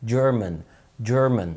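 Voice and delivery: "German" opening on a light d before the j; light initial d. Shown as a demonstration. Teacher repeating the word, "German" with light d. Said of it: "دي خفيفة في الأول كده".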